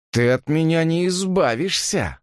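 high, slow defiant threat; drunken defiance. A narrator's reading. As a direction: refuse with a drunk attempt at menace. Drunk man defies being thrown out. (пьяный вызов, протяжно и высоко)

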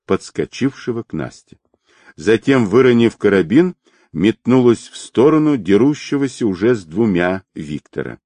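plain reading voice

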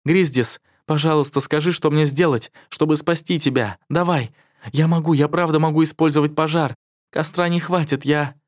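high, desperate pleading; pleading cry. A narrator's reading. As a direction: beg him to live. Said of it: "и закричала".

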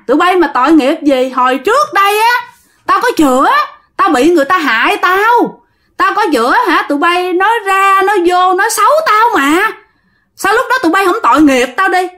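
high, resentful ghost tirade; aggrieved ghost. Acted. Wronged ghost blaming villagers for slander, high and resentful. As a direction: reproach them in a high, insistent voice. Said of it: "Cô nói vậy nha".

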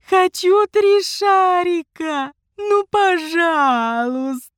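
high, slow pleading whine; whiny spoiled pouty drawl. Acted. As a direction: drag it out like a spoiled child. Child whining for ice cream.

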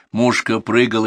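plain reading voice

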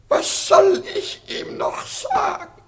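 high, breaking lament; a helpless old ruler's wail; despairing cry. Acted. Emperor despairs over the prince.

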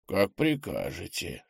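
медленный, негромкий старик; ответь медленно и тихо, как старый слуга-дух. A narrator's reading. Старый дух покорно отвечает. (тихий, медленный голос старика)

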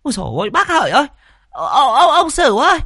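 very high, frantic village busybody; high-pitched, fussing clamour; squawking outcry. A narrator's reading. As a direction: holler in alarm to rouse the neighbours. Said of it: "mụ chu chéo".

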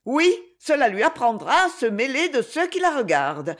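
high, scornful remark; haughty disdain. A narrator's reading. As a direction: declare she deserved it, with disdain. Lady Ophelia scornfully approves the blow.

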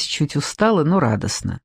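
ordinary delivery

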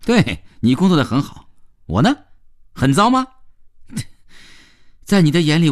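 proud worker mocking back, swinging high; sarcastic young worker. Worker defending his job to teacher, sarcastically proud. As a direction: retort with defiant self-satisfaction.

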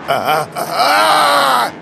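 long, high, loud scream; stuttering cry bursting into a shriek; scream of agony. A narrator's reading. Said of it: "Он завопил". (протяжный вопль боли)

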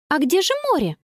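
put-on high-pitched girl's question; Connie asking. A narrator's reading. Girl to grandfather looking for sea, eager question. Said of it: "спросила Конни".

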